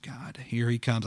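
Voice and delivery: slow, soft, resigned groan; quietly fed up, dragged out; weary annoyance. Retold or imitated. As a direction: imitate someone dreading company. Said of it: "I was that person".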